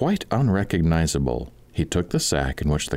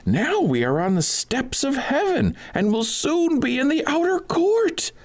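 high, ringing call to the fools; high mocking cry to the two in the sack; mock-solemn cry. A narrator's reading. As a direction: cry out high and grand as if on a journey. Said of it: "he cried".

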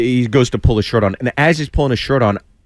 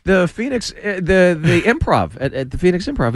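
eager retold reply; 7 semitones higher than usual.

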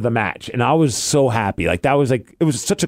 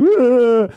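mock disgusted groan; 10 semitones higher, 4 dB louder than usual. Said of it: "Not like I was like".

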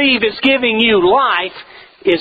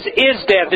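"is" leaned on, falling to death; stress on is; 2 semitones lower than usual. Shown as a demonstration. Preacher to congregation, quoting the verse, stress on "is". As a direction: press the word "is" in "is death". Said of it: "Now notice it says".

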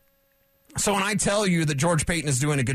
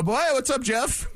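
imitating a chummy scout, pitched up high; mock friendly scout; 7 semitones higher than usual. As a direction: play the fake-friendly buddy greeting. Scouts greeting Jeff warmly.

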